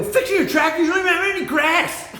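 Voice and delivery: high-pitched mock disbelief; incredulous retort. Son doubting dad's tractor story, acted incredulity. Acts out disbelieving reply to dad.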